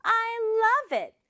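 high-pitched delighted exclamation; lively, happy. Acted. Daughter hearing her mother's idea, lively happy voice. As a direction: draw it out high and joyful. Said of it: "활기차고 기분 좋은 목소리로".

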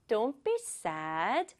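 soft, slow comforting; soothing. Acted. Mother hugging her upset daughter, soft soothing. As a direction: soothe gently and quietly. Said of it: "안아주시면서".

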